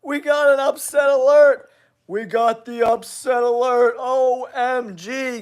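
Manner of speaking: high, urgent upset announcement; excited alert. Real, not acted. Host breaking upset news to viewers, excited alert.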